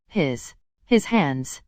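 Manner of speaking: ordinary delivery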